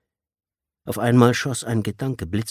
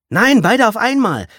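much higher, loud bragging call; loud boast; 11 dB louder than usual. Acted. Blue-haired girl loudly demands both fingers at once. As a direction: outbid the boy, loudly. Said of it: "tönte das Mädchen".

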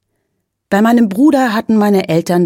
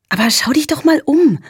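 much higher, enchanted mum voice; cheerful mother; 9 semitones higher than usual. A narrator's reading. Mother urging father to look around, high and delighted. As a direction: lift the voice in delight at the view. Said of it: "sagte Mama".

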